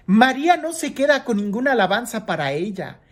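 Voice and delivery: ordinary talk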